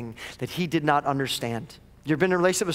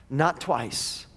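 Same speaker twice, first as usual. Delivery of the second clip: ordinary talk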